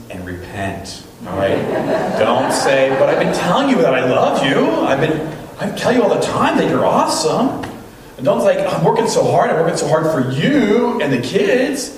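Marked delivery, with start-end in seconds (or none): 3.0-7.6 high, loud protest of an aggrieved husband; defensive husband. Acted. Mimics husband's defensive excuses. 8.6-11.9 self-justifying husband. Acted. Mimics husband's defensive excuses.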